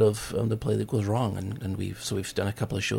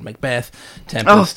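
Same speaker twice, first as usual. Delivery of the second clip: laughing; 3 semitones higher, 10 dB louder, slower than usual. Real, not acted. Daring to say the cursed name.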